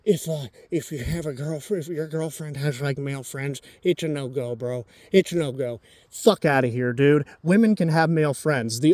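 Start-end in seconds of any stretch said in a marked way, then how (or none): 0.0-5.8 mock bro advice. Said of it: "saying shit like".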